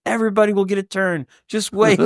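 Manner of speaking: high, hurried mock exasperation; frazzled. Dad calming five crying kids, frazzled retelling.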